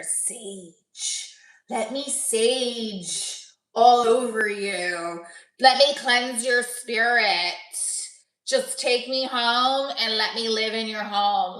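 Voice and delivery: slow, drawn-out mock seduction; mock sultry. One-liners on hot bartender's name, mock sultry. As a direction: stretch each line out like a hammed-up come-on.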